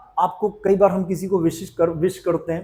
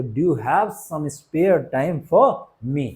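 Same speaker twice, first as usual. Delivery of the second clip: slow teaching model; much slower than usual. Shown as a demonstration. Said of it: "कैसे बोलेंगे?".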